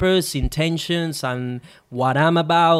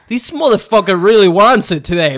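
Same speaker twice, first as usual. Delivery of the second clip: loud, high, indignant outburst; angry shock at the other surfer; outraged disbelief; 5 semitones higher, 9 dB louder than usual. Retold or imitated. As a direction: blurt it out in disbelief.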